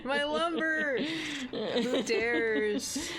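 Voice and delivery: high laughing imitation of a god; laughing mock god. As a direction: laugh through a hammed-up god voice. Echoing the god line laughing.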